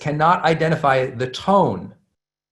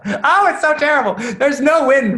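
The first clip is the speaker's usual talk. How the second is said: mock despair; 10 semitones higher than usual. Mock despair before a touchy point.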